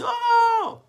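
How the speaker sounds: high, loud startled "Oh"; manager's shock. Retold or imitated. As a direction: gasp it out in sudden surprise. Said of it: "he saw that".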